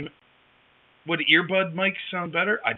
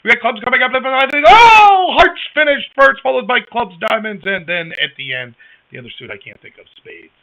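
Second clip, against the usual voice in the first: horse race announcer; 3 semitones higher, 10 dB louder than usual. Retold or imitated. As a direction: call it like a horse race, building up. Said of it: "he would always do the, like".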